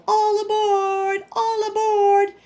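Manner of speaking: high, loud, slow call of all aboard; conductor calling out. Acted. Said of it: "She called".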